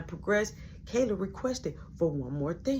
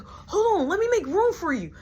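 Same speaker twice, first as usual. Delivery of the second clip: eager little brother; 11 semitones higher than usual. Retold or imitated.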